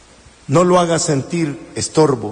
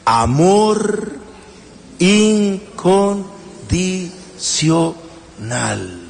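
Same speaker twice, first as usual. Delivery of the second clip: drawn-out answer; much slower than usual. Shown as a demonstration. Preacher answering his own question, very slow. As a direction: stretch each word very slowly. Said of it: "¿Cómo se llama eso?".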